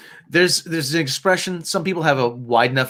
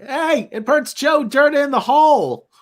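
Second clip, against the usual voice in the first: high, drawn-out mock movie-villain line; slow, raised-pitch parody of a creepy movie quote; Joe Dirt parody; 12 semitones higher, 4 dB louder, slower than usual. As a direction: do a goofy high-voiced spoof, stretching the words. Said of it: "think of now is Joe Dirt".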